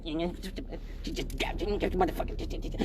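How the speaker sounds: slow, soft pained groan; strained groan. Retold or imitated. Woman on the bus groaning slowly at a pothole. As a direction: drag it out low and hurting. Moaning at each bump.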